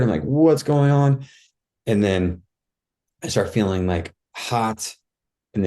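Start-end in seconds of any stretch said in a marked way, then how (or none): 0.2-1.3 higher, drawn-out question, mildly alarmed; a little scared. Retold or imitated. Retelling losing control of the body, a little scared. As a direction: act out rising unease, slowly. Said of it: "get a little bit scared".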